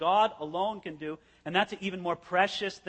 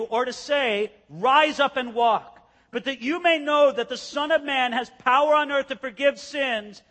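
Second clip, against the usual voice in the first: preaching scripture; 3 semitones higher, 5 dB louder than usual. Acted. Reads scripture aloud, preaching.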